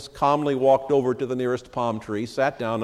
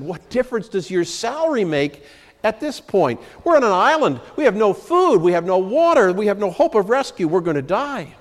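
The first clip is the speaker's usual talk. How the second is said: flabbergasted; 8 semitones higher than usual. A narrator's reading. Shipwrecked man berates his calm friend, high and exasperated. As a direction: throw up your hands in disbelief. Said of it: "The first man was flabbergasted".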